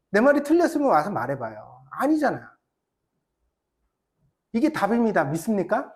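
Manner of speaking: ordinary talk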